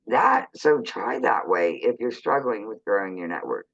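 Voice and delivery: usual speaking voice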